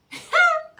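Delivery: high bright laugh; burst of laughter. Real, not acted. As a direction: laugh out suddenly. Laughs at own story.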